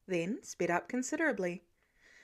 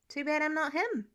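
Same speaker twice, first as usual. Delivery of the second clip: higher, mildly earnest denial; trying to sound genuine; 5 semitones higher than usual. A narrator's reading. Peter denying he is Spider-Man to Tony, would-be genuine. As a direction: attempt sincerity, not quite convincingly. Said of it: "Peter tried to sound genuine".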